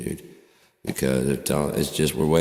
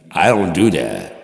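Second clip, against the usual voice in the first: mock angry denial; 7 dB louder than usual. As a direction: snap back defensively, loud. Mock argument with himself.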